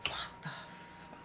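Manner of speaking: stunned, slow whispered curse; shocked whisper. Retold or imitated. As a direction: breathe it out in disbelief. Recalling shock at footage.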